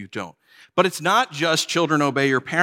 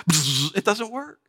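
normal talking voice

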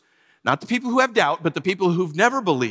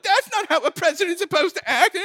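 high, whiny mock complaint ending in a sigh; whiny complainers; 12 semitones higher than usual. Preacher mocks online critics of the president, high and whiny. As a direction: whine it like a spoiled kid, higher.

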